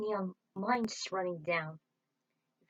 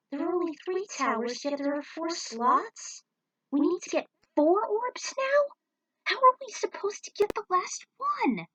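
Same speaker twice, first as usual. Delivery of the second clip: rising alarmed questions, higher; baffled and increasingly worried; panicked counting of the slots; alarmed puzzlement; 9 semitones higher, 5 dB louder than usual. Acted. Character realizes fourth orb missing.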